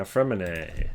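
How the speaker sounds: ordinary delivery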